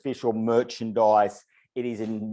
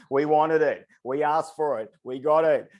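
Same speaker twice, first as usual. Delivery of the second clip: loud, higher triumphant lines; excited; 6 semitones higher, 4 dB louder than usual. Real, not acted. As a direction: hype the room up. Celebrating the top bid.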